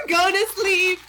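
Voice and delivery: drawn-out playful bedtime coo; mock lullaby. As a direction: coo a baby to sleep in jest, voice climbing. Mock lullaby to put chat to sleep.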